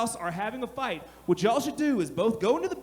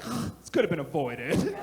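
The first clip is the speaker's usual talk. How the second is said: deadpan thought; 7 semitones lower, slower than usual. Retold or imitated. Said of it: "and I'm just like".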